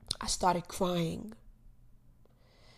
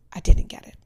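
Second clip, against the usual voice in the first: whispered; 12 dB louder, faster than usual. Real, not acted. Confessing the lost job.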